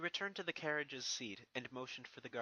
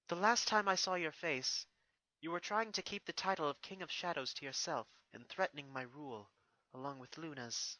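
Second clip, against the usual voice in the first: put-on higher, stately princess addressing her old enemy; regal princess voice; 4 semitones higher, 3 dB louder than usual. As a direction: speak down to a defeated foe, proud and composed. Narrator voices Celestia.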